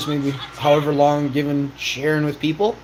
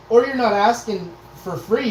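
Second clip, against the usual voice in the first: ordinary talk, nothing marked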